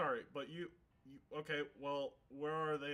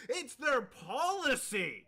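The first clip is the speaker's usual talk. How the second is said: loud, slow, drawn-out outburst; exasperated shout; 9 semitones higher, 6 dB louder, much slower than usual. Acted. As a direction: throw the point out in frustration. In-character argument, exasperated.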